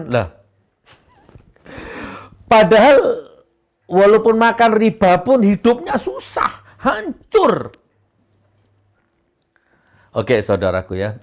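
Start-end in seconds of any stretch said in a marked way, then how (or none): 2.5-7.8 loud emphatic. Real, not acted. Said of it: "menegaskan dengan keras".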